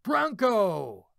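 retold loud yell. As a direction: shout Bronco like a whole company. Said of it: "yelled at the top of their lungs".

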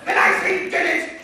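loud heated accusation; heated insistence. Acted. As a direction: shout it back in the quarrel. Quarrel over who did it.

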